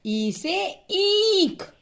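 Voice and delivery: ई से ईख with ई drawn out high and slow; stretched long ई. Shown as a demonstration. Teacher to class, stretching the long ई. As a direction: hold the ई in ईख long. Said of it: "बड़ी ई का उपयोग".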